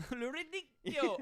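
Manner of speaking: put-on playful high-pitched Italian accent. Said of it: "doing it with an Italian accent".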